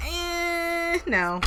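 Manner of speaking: high drawn-out "eh", then a dropped "no"; not having it. Acted. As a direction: playfully mimic a reluctant refusal. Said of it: "You guys are just not having it".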